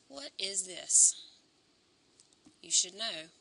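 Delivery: strained, hoarse teacher voice; losing voice. Real, not acted. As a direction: push words through a hoarse throat. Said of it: "Losing my voice".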